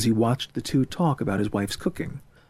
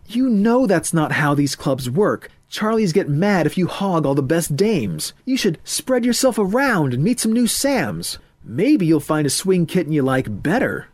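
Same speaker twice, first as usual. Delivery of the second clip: higher, punchy swing-club pal; hepcat friend advising; 6 semitones higher, 4 dB louder than usual. Acted. Friend explains how these clubs work, breezy. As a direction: school him in club rules, pitched up. Ethan lectures his friend.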